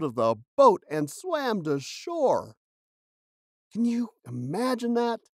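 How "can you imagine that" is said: ordinary talk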